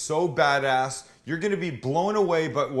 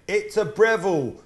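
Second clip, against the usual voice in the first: "It's a Breville" in a put-on English accent, a bit higher and louder; 6 semitones higher, 5 dB louder than usual. Shown as a demonstration. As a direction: say "It's a Breville" the way an English person would, for fun. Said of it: "I like saying words like that in, um, uh, an English accent".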